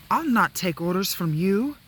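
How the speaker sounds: high, loud defiant princess. Acted. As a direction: cry out like an indignant princess. Princess defies her rescuer.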